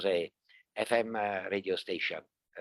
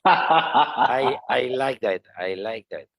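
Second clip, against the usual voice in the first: real laugh spilling into the words; loud, higher start, settling as the laugh fades; amused laughing; 4 semitones higher, 14 dB louder, slower than usual. Real, not acted. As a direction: talk through a big laugh. Laughs at joke about being youngest.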